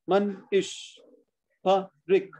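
MANISH PARIK in separate beats: Man, ish, Pa, rik; split into syllables. Shown as a demonstration. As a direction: pause inside Manish and inside Parik. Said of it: "two in each".

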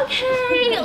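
high, tense self-coaxing; nervous resolve. Acted. Psyching herself up to approach Tara, nervously. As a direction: squeeze it out high and loud.

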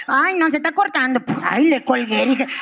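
ordinary delivery

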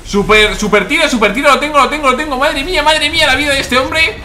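high, loud hype yelling; excited yelling. Real, not acted. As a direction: scream it out in triumph. Big kill streak celebration.

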